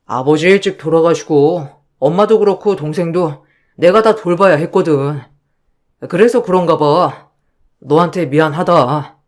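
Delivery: low, calm husband voice; husband reassuring his wife, low and calm. Acted. Husband apologizing.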